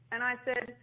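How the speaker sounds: ordinary talk, nothing marked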